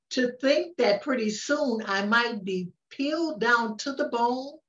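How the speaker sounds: ordinary delivery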